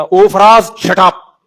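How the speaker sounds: loud fed-up shout at husband; wife yelling. Retold or imitated. Fed-up wife shouting shut up at Faraz. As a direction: snap at him, fed up. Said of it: "एक दो दफा से ज्यादा हो गया".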